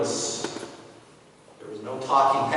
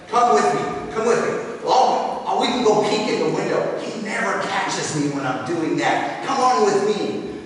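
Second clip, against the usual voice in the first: loud, high, hurried urging; eager busybody; 4 semitones higher, 5 dB louder than usual. Acted. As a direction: hurry him along to spy. Judging brother eager to spy.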